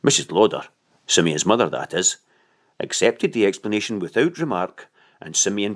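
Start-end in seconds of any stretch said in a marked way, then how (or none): none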